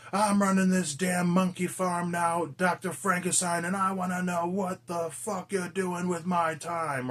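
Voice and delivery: hammed-up imitation of a bossy captain laying down the law, slowly; gruff captain. Reviewer imitating the captain scolding the doctor, slow and mock gruff. Quoting Rhodes threatening the doctor.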